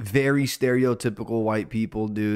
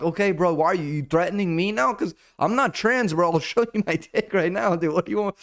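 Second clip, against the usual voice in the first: indignant protest; 8 semitones higher than usual. Retold or imitated. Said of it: "And I'm like".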